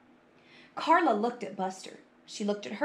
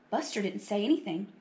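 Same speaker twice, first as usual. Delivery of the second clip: ordinary delivery, nothing marked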